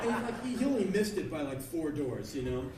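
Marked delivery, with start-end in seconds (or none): none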